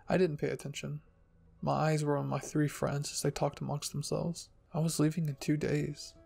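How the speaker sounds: ordinary delivery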